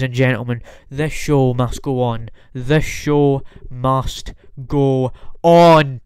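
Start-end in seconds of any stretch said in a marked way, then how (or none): 2.5-6.0 slow, grand, announcer-style proclamation; dramatic showman. Shown as a demonstration. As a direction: proclaim it like a ringmaster closing the night.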